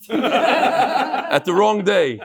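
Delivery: slow words broken by laughter; laughing. Real, not acted.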